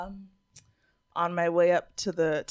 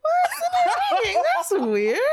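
much higher, giggling through a mock puzzled question; laughing fake confusion; 5 dB louder than usual. Retelling how she faked a dead phone to the man, laughing high. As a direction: pretend to be baffled while cracking up.

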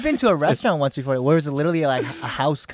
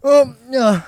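mouth full; 6 semitones higher, 5 dB louder, slower than usual. Pretend. Acting out full-mouth reply.

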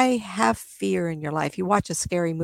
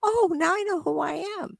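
mimicking spirit; 9 semitones higher than usual. Spirit mimicking, rising excitement.

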